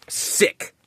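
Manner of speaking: whispered under the breath in disgust; a breathy, voiceless sneer of a word. Real, not acted. Ranting at the attacker on camera, disgusted whisper.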